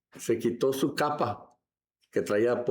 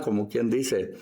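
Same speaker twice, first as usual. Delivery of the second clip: ordinary talk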